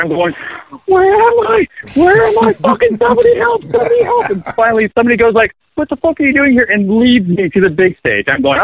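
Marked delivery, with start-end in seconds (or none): 0.8-4.3 very high-pitched mock cry for help; lost-kid yell. Bassist retelling his panicked search for the stage, hammed-up lost-kid yell. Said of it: "I'm yelling like a little kid caught". 5.7-6.6 high-pitched mock disbelief; retold incredulous question. Stranger to the lost bassist, retold incredulous question.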